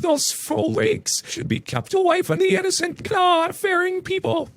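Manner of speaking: very high, slow falsetto Karen scream; hammed-up high-pitched furious mother. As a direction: shriek it up high like an outraged mother. Narrator voices Karen.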